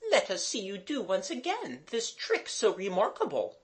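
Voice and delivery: high-pitched governess voice; Mademoiselle. A narrator's reading. Governess asking to see the trick, eager. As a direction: make the polite request in a high voice.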